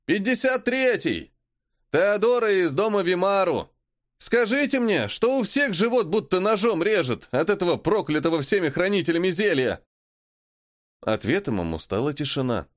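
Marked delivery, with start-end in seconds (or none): 0.0-9.8 high strained yell from afar; distant shout. Acted. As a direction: call out in pain. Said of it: "искажённый расстоянием крик".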